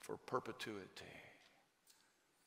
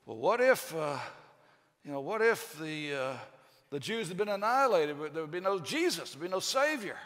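mock worried believer; 4 semitones higher, 7 dB louder than usual. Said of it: "people like to say".

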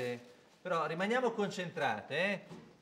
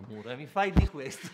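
laughing through the line; 3 semitones lower, 8 dB louder than usual. Real, not acted. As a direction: crack up mid-line. Laughing repeat.

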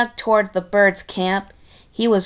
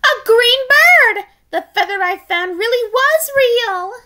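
much higher, louder squeal of joy; overjoyed; 13 semitones higher, 5 dB louder than usual. Acted. Matilda greeting the stranger, high joyful squeal. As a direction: squeal with delight. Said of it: "she jumped for joy".

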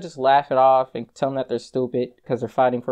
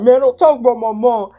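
louder, higher mock anger; angry friend; 6 semitones higher, 8 dB louder than usual. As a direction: snap back defending his mom. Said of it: "He was like".

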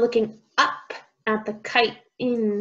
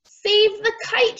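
high, urgent call to save it; raised-pitch cry for help; storybook shout; 11 semitones higher, 3 dB louder than usual. A narrator's reading. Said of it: "shouted Mole".

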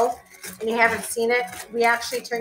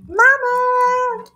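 calling out; 13 semitones higher, 6 dB louder than usual. Real, not acted. Calling out across the house.

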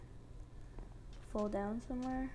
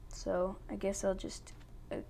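ordinary talk

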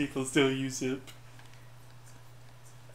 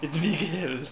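put-on Spanish accent. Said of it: "my highly offensive Spanish accent".

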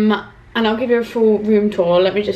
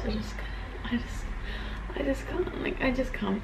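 barely audible, breaking off and restarting; real choked-up near whisper; emotional; 14 dB quieter than usual. Real, not acted. Vlogger remembering her dad crying, emotional and hushed. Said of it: "It was a very emotional day".

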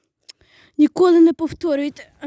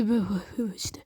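whisper; 9 semitones lower, 11 dB quieter, faster than usual. Real, not acted. Apologising while hiding.

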